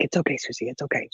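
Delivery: soft, low reassurance; gentle murmured comfort, acted out; imitated soothing grandma. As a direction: hush a child to sleep, very softly.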